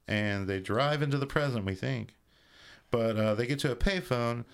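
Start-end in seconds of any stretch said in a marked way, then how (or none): none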